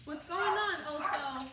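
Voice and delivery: high, loud excited greeting. Real, not acted. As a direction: call out to a dog happily.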